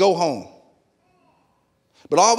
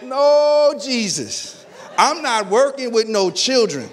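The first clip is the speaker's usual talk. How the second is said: mock outcry; 5 semitones higher, slower than usual. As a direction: cry out against kids duty, half-laughing. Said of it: "I'm like".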